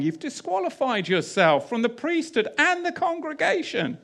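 high-pitched, emphatic pronouncement; Shaul's rebuke. Acted. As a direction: voice Shaul's verdict, pitched right up without shouting. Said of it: "Shaul is saying".

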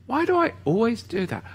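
high, soft self-reproach; exasperated at oneself. Real, not acted. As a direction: climb into a high, quiet plaint at yourself. Berating himself for getting fired up.

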